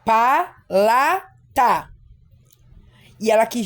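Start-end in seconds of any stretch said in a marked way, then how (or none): 0.0-1.9 palata broken into pa, la, ta with long pauses; very slow, each syllable set apart. Shown as a demonstration. Teacher showing a child's misspelt word, syllable by syllable. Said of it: "é muito comum, né, a criança escrever".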